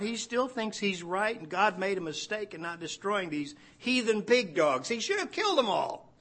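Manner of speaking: hammed-up outrage at the heathen; mock angry Jonah. Preacher voicing Jonah's outrage at Nineveh, mock angry. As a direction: pitch rising into an indignant rant.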